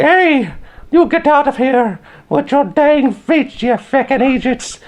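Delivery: very high, slow, put-on Irish old woman; old Irish lady. Old lady outside the pub scolds peanut throwers, high Irish voice. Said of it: "the old Irish lady".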